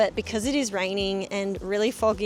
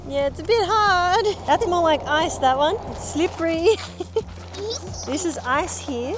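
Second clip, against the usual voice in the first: excited; 8 semitones higher, 6 dB louder than usual. Real, not acted. Playing in snow, shrieking.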